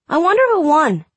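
put-on high small boy voice; a sick little boy; young boy voice. Child Drew voiced high.

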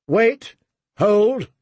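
high, urgent held-back order; shouted command. A narrator's reading. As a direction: call out the order to the line. Commander holds back his archers.

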